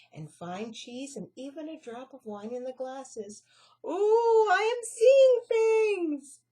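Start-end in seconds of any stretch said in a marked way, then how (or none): 3.9-6.4 very high, loud, slow amazed squeak. Acted. Annie squeaking in amazement at the food. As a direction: squeal in wonder. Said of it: "squeaked Annie".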